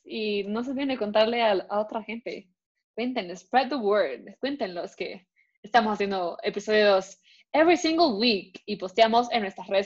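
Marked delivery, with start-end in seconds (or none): none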